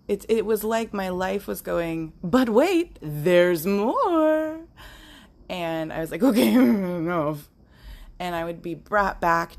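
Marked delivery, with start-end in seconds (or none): 2.2-4.6 higher, louder salesman hype; infomercial announcer. Pretend. Life mocking her like a TV pitchman, loud and hyped. 6.2-7.5 laughing exasperation. Real, not acted.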